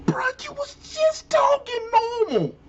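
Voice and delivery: high, whining mock sob; fake-cry in exasperation. Exasperated call-out of accent switch.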